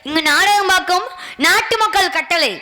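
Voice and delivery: stage king declaiming formally; measured theatrical oratory; mock-royal proclamation. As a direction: proclaim it to the whole audience, phrase by phrase. Stage king proclaims to his minister.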